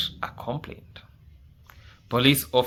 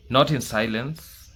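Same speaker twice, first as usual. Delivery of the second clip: ordinary talk, nothing marked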